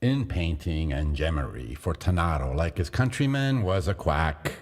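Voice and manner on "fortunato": ordinary delivery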